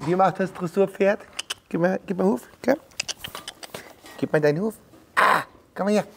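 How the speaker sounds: high, playful talk to a foal. Real, not acted. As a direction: coax the foal sweetly.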